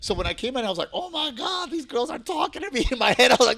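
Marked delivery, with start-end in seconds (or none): 0.9-2.9 high-pitched, excited inner voice; thrilled. Retold or imitated. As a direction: act out delighted disbelief. Retelling his delighted inner thoughts.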